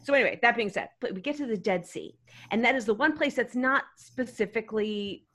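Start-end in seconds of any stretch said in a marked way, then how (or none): none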